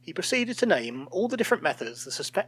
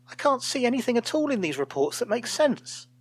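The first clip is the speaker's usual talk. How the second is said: much higher, alarmed disbelief; aghast; 9 semitones higher than usual. A narrator's reading. Old colleague staring at empty reports, aghast. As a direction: voice jumps up in dismay.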